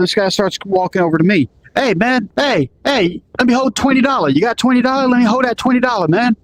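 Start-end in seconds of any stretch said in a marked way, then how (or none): 1.8-6.4 put-on pushy young hustler, pitched higher; street kid voice. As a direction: beg hard like a street kid with a knife. Teen hustler asking for money.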